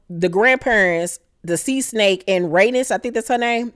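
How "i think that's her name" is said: ordinary talk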